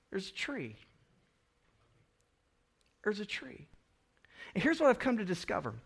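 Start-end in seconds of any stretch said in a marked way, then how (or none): 0.1-3.7 calm God. Retold or imitated. God's calm answer.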